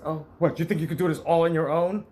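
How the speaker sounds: sneering mother. Retold or imitated. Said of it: "she goes and says".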